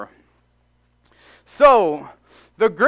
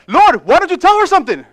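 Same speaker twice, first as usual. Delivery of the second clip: high, loud irritated plea to Jesus; Martha's complaint; 10 semitones higher, 11 dB louder than usual. Retold or imitated. Martha, irritated, complaining to Jesus about her sister, loud and high. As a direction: whine it at the Lord, fed up. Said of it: "she said".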